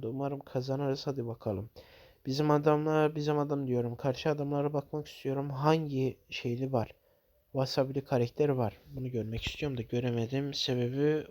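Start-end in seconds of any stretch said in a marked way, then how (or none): none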